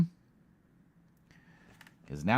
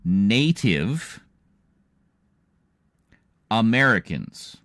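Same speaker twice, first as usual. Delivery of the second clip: Native Americans drawn out word by word, loud; each syllable of Native Americans slow and separate; over enunciating; 3 semitones higher, 6 dB louder, slower than usual. Shown as a demonstration. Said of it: "let me say that again slowly".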